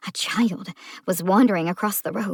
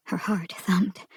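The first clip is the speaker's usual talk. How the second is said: tense whisper; 4 dB quieter, slower than usual. A narrator's reading. Panic rising.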